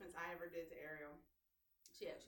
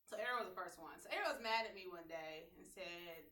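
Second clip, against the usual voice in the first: usual speaking voice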